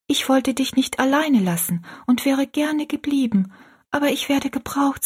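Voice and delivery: put-on young girl. A narrator's reading.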